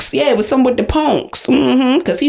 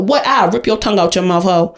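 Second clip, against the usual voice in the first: normal talking voice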